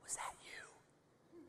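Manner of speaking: scared whisper. Acted. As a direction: whisper it, frightened. Robber whispering in the dark.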